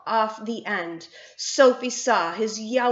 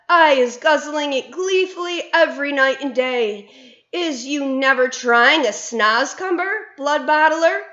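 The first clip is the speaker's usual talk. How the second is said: higher, eager giant praising his food; cheerful BFG; 6 semitones higher, 7 dB louder than usual. A narrator's reading. BFG luring Bloodbottler off track, eager. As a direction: sell it with fake delight.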